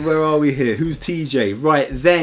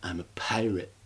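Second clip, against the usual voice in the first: deadpan mumble; 2 semitones lower, 9 dB quieter than usual. Retold or imitated. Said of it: "he's like".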